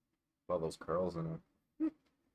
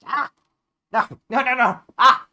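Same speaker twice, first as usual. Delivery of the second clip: high loud yelping no; pained yelps; 19 dB louder than usual. Real, not acted. Cat clawing her, high yelping protests. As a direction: yelp as claws dig in.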